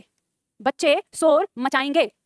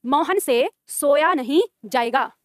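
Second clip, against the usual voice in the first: slow, word by word for writing down; slow dictation; 2 semitones lower, much slower than usual. Shown as a demonstration. Teacher dictating the changed sentence, slowly. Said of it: "Mohan नहीं सोएगा".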